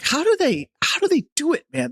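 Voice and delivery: high, incredulous question; mock disbelief. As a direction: act baffled at politicians. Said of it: "I'm like".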